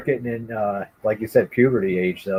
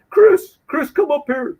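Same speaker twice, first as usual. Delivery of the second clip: calling out; 14 semitones higher, slower than usual. Retold or imitated. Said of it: "He goes".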